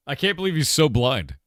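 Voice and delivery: ordinary talk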